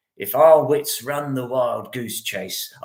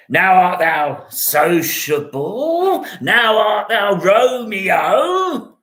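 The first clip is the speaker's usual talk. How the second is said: slow teasing; 4 semitones higher, much slower than usual. Acted. Friend teases Romeo back to himself, slow and playful. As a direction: savour each word, teasing.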